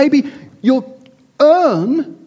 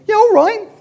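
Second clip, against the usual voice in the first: dismissive father; 8 semitones higher, faster than usual. Acted. Father waving off his falling daughter's cry, hammed-up impatience. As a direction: wave her off impatiently, mock unbothered.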